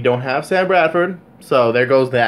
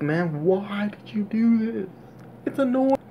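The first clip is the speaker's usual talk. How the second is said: slow, breathy whispered plea, softer; despairing whisper; 8 semitones higher, 6 dB quieter, slower than usual. Real, not acted. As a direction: whisper it like you can't believe it. Fan despairing at team's move.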